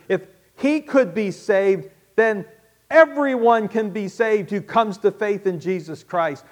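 rally the congregation, rising each time; rousing pulpit crescendo; impassioned preaching. Acted. Preacher builds a rising list.

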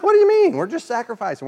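sudden high squeak of disbelief, then dropping; mock outrage, starting very high; baffled protest. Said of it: "they're like".

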